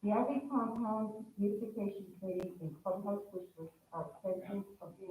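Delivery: muffled and quiet behind a mask; through a mask. Real, not acted. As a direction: talk through a face mask. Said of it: "Can you hear me okay with my mask on?".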